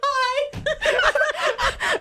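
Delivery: very high squeaky hello; mock cutesy greeting. Imitating a boy-band singer greeting her friend, high mock cutesy. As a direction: squeak it out, drawn out.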